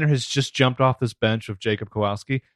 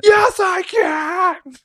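squeaky high scream, dragged out; 4 dB louder, much slower than usual. Retold or imitated. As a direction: shriek it, way up high and slow. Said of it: "could you speak up".

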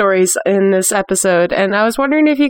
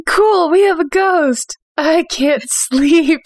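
higher and slow, then laughing it off; mock excited ghost owner; 7 semitones higher, slower than usual. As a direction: play a thrilled new ghost owner who soon can't sleep.

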